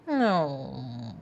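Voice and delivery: low whining dog voice; dog whine. Shown as a demonstration. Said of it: "let me demonstrate it for you guys".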